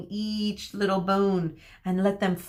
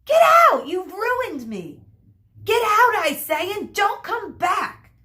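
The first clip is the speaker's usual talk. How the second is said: very high, loud bursts; furious scream; 9 semitones higher, 6 dB louder than usual. A narrator's reading. Hairdresser throws Medusa out, screaming furiously. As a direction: shriek each order to leave. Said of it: "screamed Monsieur Joseph".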